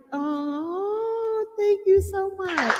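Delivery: high, touched mock whimper; close to tears. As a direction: thank them with a hammed-up tearful squeak. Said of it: "I'm about to have some tears".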